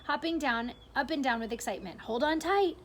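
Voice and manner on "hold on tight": excited. Acted. Grasshopper calls out in lurch.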